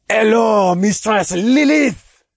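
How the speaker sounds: put-on loud, slow French-accented greeting. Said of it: "through a thick French accent".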